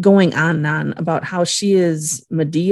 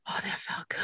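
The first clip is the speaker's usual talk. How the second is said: hushed satisfied whisper; a soft whispered sigh of relief; 20 dB quieter, faster than usual. Retold or imitated. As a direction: breathe it out in a whisper. Winona's relief, retold.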